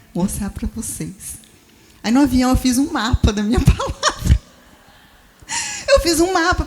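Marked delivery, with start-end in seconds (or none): 2.0-6.6 laughing through the words. Real, not acted. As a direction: break into real laughter mid-sentence. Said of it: "Tava rindo ali". (rindo de verdade ao falar do mapa)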